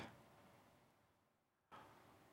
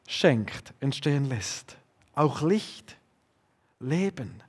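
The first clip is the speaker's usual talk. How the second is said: soft; 6 semitones lower, 10 dB quieter, slower than usual. Acted. Soft close of the list.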